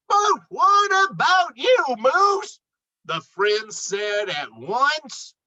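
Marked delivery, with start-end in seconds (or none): 0.1-2.6 high anxious asking; alarmed, asking together; worried question. Acted. Friends ask together.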